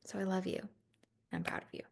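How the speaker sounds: soft whispered reassurance; real heartfelt whisper; tender whisper. Real, not acted. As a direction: whisper it close to the mic. Tender sign-off to listeners.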